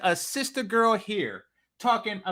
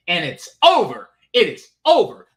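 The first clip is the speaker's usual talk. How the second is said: louder, punching it is over; emphatic; 8 dB louder than usual. Real, not acted. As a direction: slam the verdict down. Emphatic repeat of the verdict.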